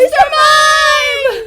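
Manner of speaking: huge, high drawn-out scream; excited shout. Real, not acted. Parent revealing the pack's big pull, excited shout. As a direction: stretch the name into a thrilled yell.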